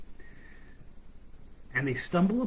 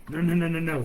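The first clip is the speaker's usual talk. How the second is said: rapid, louder string of no's; urgent interruption; 5 semitones higher, 5 dB louder, faster than usual. Acted. Mouse stops girl keeping the ribbon, urgent. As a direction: cut in fast and insistent.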